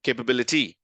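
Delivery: kay-puh-bil-i-TEE, weight on the final syllable; variant syllable stress. Shown as a demonstration. Teacher offering last quiz option, "capability" stressed at the end. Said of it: "different versions of the pronunciation of this word".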